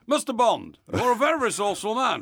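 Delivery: big theatrical master villain retake; Goldfinger. Acted. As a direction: address the spy with grand menace. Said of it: "this is Goldfinger".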